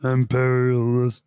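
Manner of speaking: slow, drawn-out imperious English accent. Shown as a demonstration. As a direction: drag out the word like a snooty Englishman. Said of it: "do it for me again".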